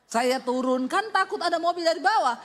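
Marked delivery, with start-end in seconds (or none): none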